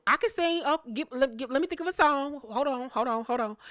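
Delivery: high, stumbling imitation of a singer; mock flustered. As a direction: stall nervously in a high little voice. Mimics flustered girl asked to sing.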